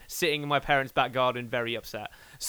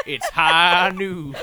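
very slow, louder cowboy drawl; McCree cowboy drawl; 2 semitones higher, 7 dB louder, much slower than usual. Shown as a demonstration. British guest doing the Overwatch cowboy line, slow cowboy drawl. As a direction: stretch it out like a gunslinger. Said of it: "McCree from Overwatch".

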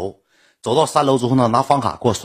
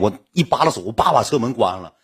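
ordinary talk

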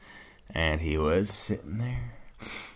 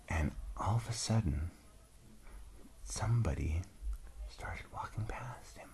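softly, like someone creeping past; hushed narration; 2 semitones lower, 13 dB quieter than usual. A narrator's reading. Storyteller whispering to the child as someone sneaks past, hushed. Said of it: "It was really quiet".

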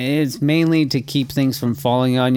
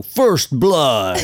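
game announcer voice; 2 semitones higher than usual. Retold or imitated. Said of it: "you'd hear that announcer go".

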